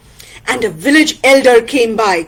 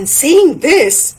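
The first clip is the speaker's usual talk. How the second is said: ordinary delivery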